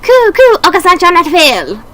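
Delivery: high, loud birdlike voice, cooing; a fierce little dove vowing to win; excited pigeon character. Acted. Pigeon Oka-san rallying friends in a high voice.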